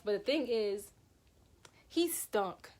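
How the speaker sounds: ordinary delivery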